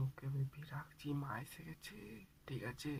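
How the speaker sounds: very soft, secretive sign-off; hushed voice fading to almost nothing; furtive whisper. Acted. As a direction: whisper a hurried goodbye before getting caught. Hanging up as mother arrives.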